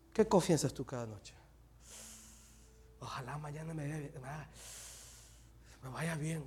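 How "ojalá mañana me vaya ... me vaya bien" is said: very soft, muted acted wish; barely voiced, half-hearted hope; timid hopeful murmur. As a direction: murmur it as a doubtful little prayer. Acts out a timid nightly confession.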